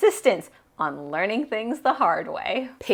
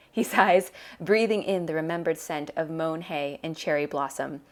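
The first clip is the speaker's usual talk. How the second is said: ordinary delivery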